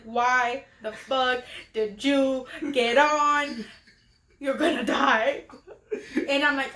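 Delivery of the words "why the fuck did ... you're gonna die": slow, dragged-out inner voice; slow panicked inner monologue. Retold or imitated. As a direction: talk to yourself slowly in dread. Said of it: "in my head, I'm just like".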